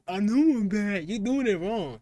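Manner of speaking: usual speaking voice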